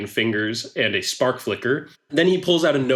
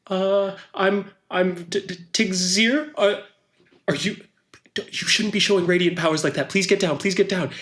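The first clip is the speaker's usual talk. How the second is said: high, stammering character voice; stammer, then hurry out a worried plea; anxious onlooker; 7 semitones higher than usual. Acted. Tigzir begs the dangling boy to get down, anxious.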